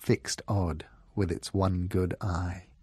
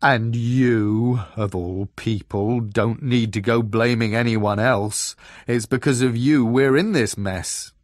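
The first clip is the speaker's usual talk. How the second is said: the gloomy bear turns to blame; louder, pointed accusation; changed, accusing tone; 7 dB louder than usual. A narrator's reading. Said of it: "changed its tone".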